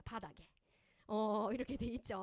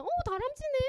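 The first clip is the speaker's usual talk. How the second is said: much higher, louder gushing cry; delighted neighbor mom; 6 dB louder than usual. Retold or imitated. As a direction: gush like a mom thrilled to see a kid climb. (나무 타는 아이 보고 감탄하는 옆집 엄마, 높고 크게 들뜬 목소리)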